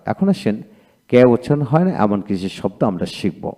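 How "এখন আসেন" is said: ordinary talk, nothing marked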